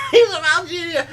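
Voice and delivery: high, loud, laughing and crying; laughing hard. Real, not acted. Finishing the Algeria story, laughing helplessly. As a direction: talk through helpless laughter.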